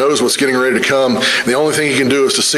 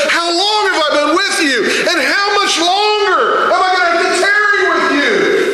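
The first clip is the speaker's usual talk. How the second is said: shouted rebuke. Retold or imitated. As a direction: shout it as the climax. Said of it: "and said".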